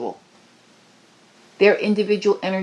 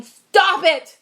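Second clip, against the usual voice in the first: commanding cry; 8 semitones higher, 4 dB louder than usual. Retold or imitated. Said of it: "is saying".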